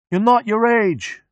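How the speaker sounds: high, slow yell. A narrator's reading. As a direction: answer the chant with a yell. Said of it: "Mechanic yells".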